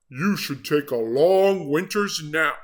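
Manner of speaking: odd sleepy bear voice; sleepy animal. Acted. A sleepy animal advising Jim. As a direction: suggest it drowsily.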